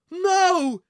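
high, loud desperate scream; panicked scream. A narrator's reading. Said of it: "screamed the man".

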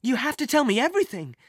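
fast, high, urgent plea; frantic. Acted. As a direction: press for answers desperately. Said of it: "he went on frantically".

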